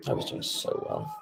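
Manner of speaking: breathy whisper under the breath; whispered lament. Real, not acted. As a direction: whisper it to yourself, deflated. Repeats the moan under breath.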